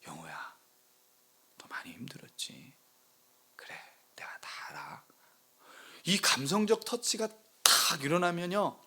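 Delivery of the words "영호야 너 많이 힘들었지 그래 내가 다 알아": low, soft whisper of a consoling God; God's comforting voice. Retold or imitated. God consoling the pastor by name, a low tender whisper. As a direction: whisper it like a father comforting his child. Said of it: "위로하시는 하나님".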